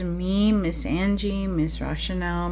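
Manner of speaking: normal talking voice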